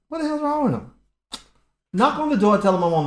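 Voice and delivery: high, loud exasperated outburst; exasperated mom. Retold or imitated. Mom on the phone, fed up with her son, high and loud.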